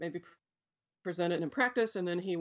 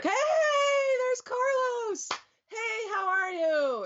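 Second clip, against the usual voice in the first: high, slow, sing-song hello; mock greeting; 3 dB louder, slower than usual. Seers greeting Carlos on arrival, high hammed-up sing-song. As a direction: ham up an overjoyed welcome, stretched out. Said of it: "And they would be like".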